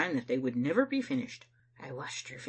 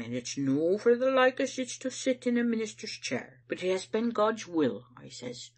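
solemn, self-important pronouncement, rising louder; stern declaration with raised voice; 6 semitones higher, 4 dB louder than usual. A narrator's reading. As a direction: lay down the law like a church elder. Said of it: "I says to her sternly".

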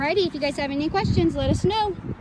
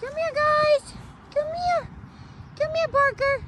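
much higher sing-song call to the animals; pet call; 3 dB quieter than usual. Real, not acted. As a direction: coax them over, high and bright. Calling the animals again.

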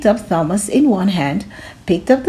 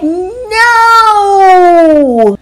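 put-on high, loud child's no; defiant shout; 9 semitones higher, 7 dB louder than usual. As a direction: yell it back at the principal. Thomas refuses principal.

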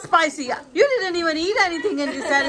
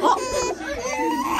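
laughing; 10 semitones higher than usual. Real, not acted. Mother laughing at baby.

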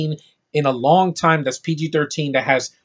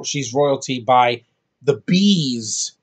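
usual speaking voice

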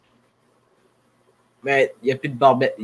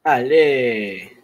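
usual speaking voice

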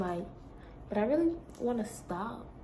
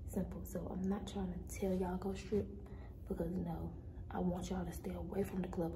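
hushed whispering; 13 dB quieter than usual. Real, not acted. Hushed talk throughout opening.

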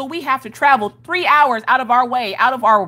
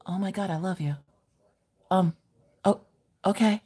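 smitten hush; 3 semitones lower, 8 dB quieter than usual. Retold or imitated. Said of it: "And I was like".

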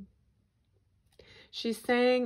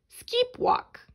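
very high, strange chirping voice; bird's call; 5 dB louder than usual. Acted. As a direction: chirp it like a little bird. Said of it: "replied the bird".

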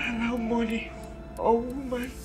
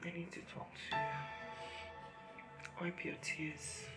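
weak whisper; 13 dB quieter than usual. Acted. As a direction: gently whisper through weakness. Dying mother to children.

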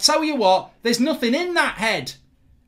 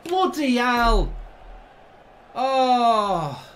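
despairing; 4 semitones lower, much slower than usual. Real, not acted. Slow, despairing.

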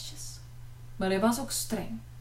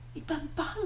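small, high, soft frightened voice; frightened squeak; 6 semitones higher, 8 dB quieter than usual. A narrator's reading. Tina afraid before the thumping wardrobe, squeaking. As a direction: squeak it out timidly. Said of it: "piepte Tina".

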